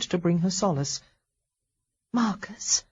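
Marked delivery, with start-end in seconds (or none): 2.1-2.8 breathy unvoiced whisper of his name. Wife whispers to unconscious husband.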